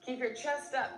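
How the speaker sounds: ordinary talk, nothing marked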